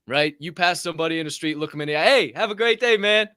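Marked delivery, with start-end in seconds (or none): 2.0-3.3 bright, loud, high friendly hello; cheerful greeting. Shown as a demonstration. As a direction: greet a stranger with real enthusiasm. Demo of cheerful street greeting.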